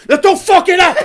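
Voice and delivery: very high, loud chef shout; hammed-up screamed warning; sudden mock yell. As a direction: explode right after the praise. Imitating chef's sudden yell.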